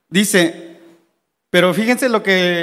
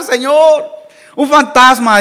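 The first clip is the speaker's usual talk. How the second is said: loud, higher cry of alarm; frightened shout; 6 semitones higher, 7 dB louder than usual. Acted. Disciples in the boat crying out at a figure on the water, loud frightened shout. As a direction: yell it out like you have just seen a ghost. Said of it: "empezaron a gritar".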